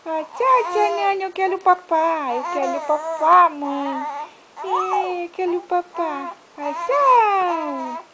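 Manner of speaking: put-on loud, high whining baby voice; loud mock baby whine, begging for food; whiny baby voice. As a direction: whine like a hungry baby who wants food. Mother voices crying baby's plea.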